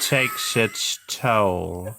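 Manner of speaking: very slow, drawn-out butler imitation; Rocky Horror butler. Finishing the musical quote for friends, creepy butler imitation. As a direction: draw each word right out, theatrical butler. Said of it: "sound like the butler from Rocky Horror".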